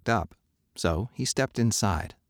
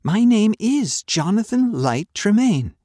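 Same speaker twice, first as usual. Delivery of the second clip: much higher and louder, defiant; insistent; 13 semitones higher, 7 dB louder than usual. Acted. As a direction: hammer the name home, My name is Jonathan Light Tremaine. Boy insists on his name.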